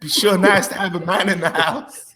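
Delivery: high mock Southern belle, laughing through it; coy Southern lady. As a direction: sigh it like a lonely housewife. Still voicing Jenny.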